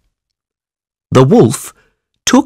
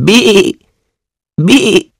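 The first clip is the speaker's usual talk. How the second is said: high, bleating goat cry; 11 semitones higher than usual. Acted. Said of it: "said the goat".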